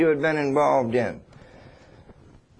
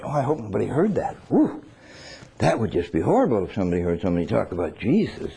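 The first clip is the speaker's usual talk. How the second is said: nervous fretting; 7 semitones lower, faster than usual. Retold or imitated. Embarrassed believer whispering worries.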